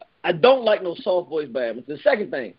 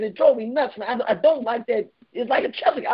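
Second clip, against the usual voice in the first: ordinary delivery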